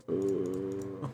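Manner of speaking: ordinary delivery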